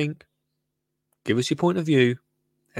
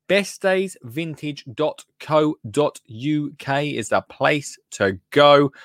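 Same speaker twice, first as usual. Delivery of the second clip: slow ad read; slower than usual. Shown as a demonstration. Slow ad URL.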